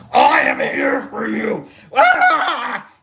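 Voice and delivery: shouted, slow, then a high yell; ecstatic shout. Retold or imitated. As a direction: yell up at the stage, carried away. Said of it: "reaching up for it".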